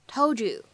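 "told you" blended into "tol-joo"; "told you" linked as one word, "tol-joo"; assimilated sounds. Shown as a demonstration. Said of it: "我们呢可以变成told you".

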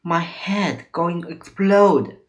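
acted cry of agony; pained cry. A narrator's reading. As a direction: cry it out slowly, in pain. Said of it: "he cried".

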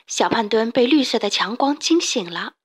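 ordinary delivery, nothing marked

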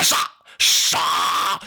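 loud shouted charge; rally the troops forward; battle cry. A narrator's reading. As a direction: Zhang Fei's army yelling as it charges.

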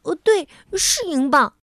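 muddled lady's certain reply; confident. Acted. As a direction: answer firmly though she's wrong. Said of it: "糊涂小姐肯定地回答".

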